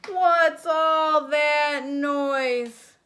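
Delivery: slow, loud, high grumpy bear roar; cranky bear shouting slowly at the noise; grumpy bear bellow. Acted.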